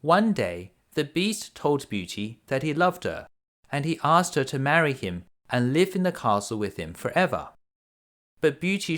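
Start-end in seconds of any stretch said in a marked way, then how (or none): none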